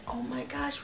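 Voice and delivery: breathless whisper; shy whisper. Retold or imitated. Whispering to her cousin, nervous to meet the band. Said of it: "I'm shy".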